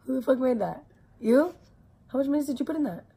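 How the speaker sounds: loud, high, disbelieving; shocked outburst. Real, not acted. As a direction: react as if it's gross. Shocked at sibling's food.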